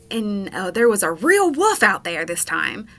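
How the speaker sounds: ordinary talk, nothing marked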